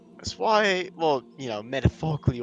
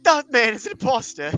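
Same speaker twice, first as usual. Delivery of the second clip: high accusing nobleman voice; indignant lord; 8 semitones higher, 7 dB louder than usual. Retold or imitated. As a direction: point and cry imposter.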